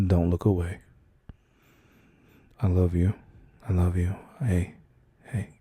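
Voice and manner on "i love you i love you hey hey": ordinary talk, nothing marked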